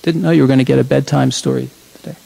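ordinary delivery

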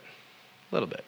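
breathy under-the-breath answer; whispered aside. Real, not acted. Quiet aside after rhetorical question.

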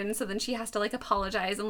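ordinary talk

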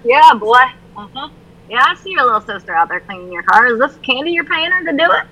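knowing, sarcastic mom. Retold or imitated. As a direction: call him out wryly.

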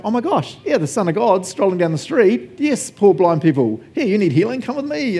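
high-pitched, eager calling out; excited. Acted. Crowd member inviting blind men to be healed, excited. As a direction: act out a thrilled bystander rushing to help. Acts out a delighted, helpful crowd.

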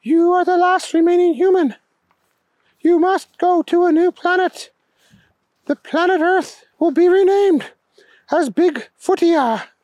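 high-pitched alien proclamation, loftily announced; alien king, solemn. A narrator's reading.

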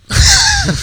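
high, loud laugh bursting out; burst of laughter. Real, not acted. Laughing at the stoned friend's reaction, big laugh. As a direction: break up laughing.

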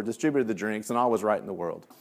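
ordinary talk

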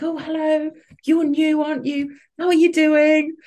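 high, bright sing-song greeting, mimicking the parents; mock-chirpy parents cooing at the new mum; chirpy school mum. Parents on the touchline greeting the new parent, high chirpy mimicry.

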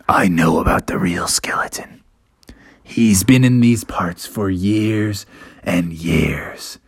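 low, slow, whispery legend-telling; grave Father Bear. Acted. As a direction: recount it slowly in a hushed, low voice.

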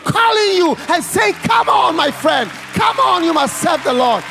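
high, loud pleading appeal; impassioned call. Acted. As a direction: urge them forward like the Spirit calling. Voicing the Spirit's call.

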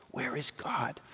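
breathy whisper, barely audible; whispered plea. Acted. A grieving sister whispering her doubt to the empty room. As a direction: let the last question fall to a whisper. Sisters' final anguished question.

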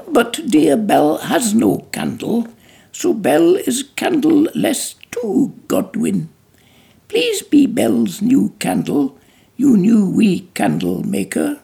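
high, eager, singsong wordplay; childlike Belle. Acted. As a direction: plead sweetly like a clever child. Belle's playful reasoning.

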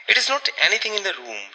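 fast, stubborn, horrified denial; obstinate horror. A narrator's reading. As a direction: insist on it in dread. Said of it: "repeated Rebecca with obstinate horror".

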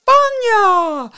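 high, loud shrieked summons; drawn-out high yell. A narrator's reading. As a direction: shriek a name across the room. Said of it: "A shriek went up for".

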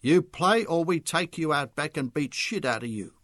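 loud menacing Charlie voice; angry snap. A narrator's reading. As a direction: threaten him angrily. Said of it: "Charlie snapped angrily".

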